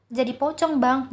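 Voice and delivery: usual speaking voice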